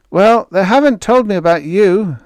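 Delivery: loud amused sailor voice; grinning Moore. A narrator's reading. Moore teases the new stowaway, grinning. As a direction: grin through it, booming. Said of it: "the fellow grinned".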